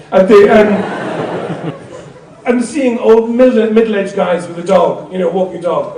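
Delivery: usual speaking voice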